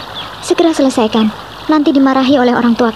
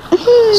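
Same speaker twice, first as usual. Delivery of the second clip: laugh; 11 semitones higher than usual. Acted. Teasing friend about sulking face.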